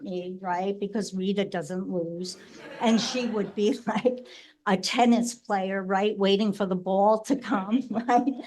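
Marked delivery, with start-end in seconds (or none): none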